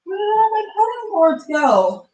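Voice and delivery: high, puzzled outcry; baffled at missing boards; dismayed. Real, not acted. Can't find boards, dismayed.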